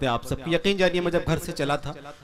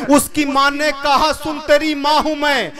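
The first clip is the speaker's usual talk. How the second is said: measured, declaiming recital of a love poem; poetry recital; 9 semitones higher, 12 dB louder, slower than usual. Acted. Poet reciting his nazm to the mushaira audience, declaiming. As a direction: recite each couplet to the gathering.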